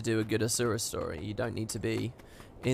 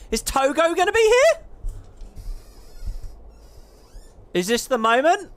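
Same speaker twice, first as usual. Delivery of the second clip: high, loud eager question; excited; 7 dB louder than usual. Real, not acted. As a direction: burst out with hopeful excitement. Excitedly hoping to see Togo.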